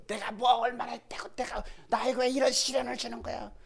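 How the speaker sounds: high-pitched imitated whining; aggrieved protest. Stoned believer asking why this trial, aggrieved protest. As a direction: complain upward in a high voice. Voices stoned man complaining to God.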